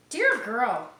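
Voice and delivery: loud, bright, slow storybook read; reading to children. Acted. Teacher reading her favourite page, loud and bright. Reads picture book aloud.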